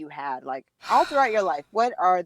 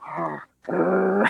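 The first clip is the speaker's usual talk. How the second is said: imitated dog growl. Owner mimicking her dog's answer, imitated growling. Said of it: "And then she'll go".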